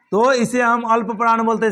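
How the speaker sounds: ordinary talk, nothing marked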